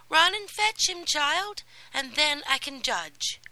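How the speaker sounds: high, loud, eager commands; excited fairy calling for the mousetrap; urgent fairy godmother. Acted. Godmother orders.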